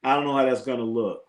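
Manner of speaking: ordinary talk, nothing marked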